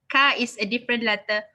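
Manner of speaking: ordinary talk, nothing marked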